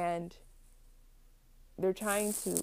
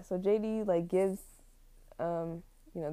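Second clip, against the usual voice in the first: ordinary talk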